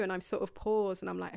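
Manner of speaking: ordinary talk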